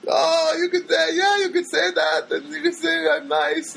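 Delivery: put-on high, slow, pleading girlish voice; imitating her coaxing. Girl coaxing him for survey.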